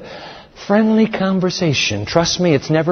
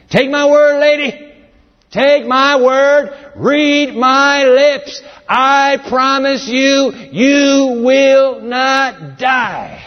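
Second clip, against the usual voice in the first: high, very slow, rising to a shout; forceful serpent; 9 semitones higher, much slower than usual. Acted. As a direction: insist hard like a con man swearing. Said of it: "The serpent's language is as forceful".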